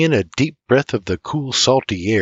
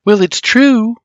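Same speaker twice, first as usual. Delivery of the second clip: ordinary talk, nothing marked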